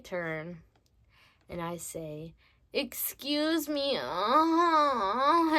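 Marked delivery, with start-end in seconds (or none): 2.7-4.0 drawn-out higher moaning excuse me; mock moan. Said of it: "and I start moaning". 4.0-5.6 high loud moaning uh-huh; mock moan. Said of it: "and I start moaning".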